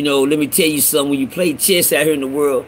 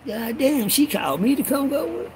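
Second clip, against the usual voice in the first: high, hushed voice of amazement; astonished aside; 11 semitones higher, 4 dB quieter than usual. Acted. Inmate telling himself the lady picked him, astonished. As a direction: squeal it softly in mock disbelief. Acting out his astonished reaction.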